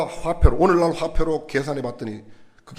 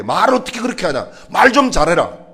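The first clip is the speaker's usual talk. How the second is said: louder, higher rebuke of the father's words; rebuking tone; 4 semitones higher, 7 dB louder than usual. Retold or imitated. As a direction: scold him like a stern teacher. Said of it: "예수님께서 지적하는 것은". (아버지를 꾸짖는 예수님, 크고 높게 나무라듯)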